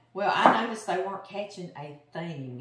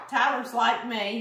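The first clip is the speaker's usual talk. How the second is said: ordinary talk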